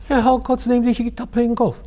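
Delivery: high-pitched Scottish questioning; Scottish accent. Acted. Colleague questioning McDougall about golf, Scottish accent. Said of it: "my Scottish accent".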